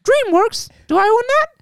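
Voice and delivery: piercing squeak, very high; bossy squeaky cartoon mouse; Mickey Mouse voice. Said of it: "I'm gonna do the Mickey Mouse voice".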